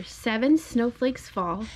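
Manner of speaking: usual speaking voice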